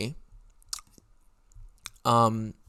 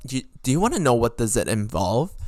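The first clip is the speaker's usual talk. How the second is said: ordinary delivery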